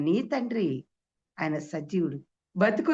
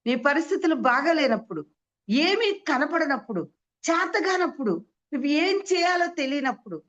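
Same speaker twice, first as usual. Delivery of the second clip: higher, louder pleading build; impassioned preaching; 8 semitones higher, 5 dB louder than usual. Acted. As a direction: cry it out to the congregation. Preacher appeals at full force. (ఉద్వేగంగా గట్టిగా ప్రసంగం)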